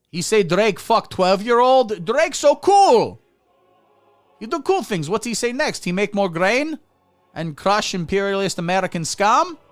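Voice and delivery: high, loud mock Russian accent. Comic as Russians hearing a diss track, mock Russian accent. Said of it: "obviously doing Russian vo-, like, Eastern European voice".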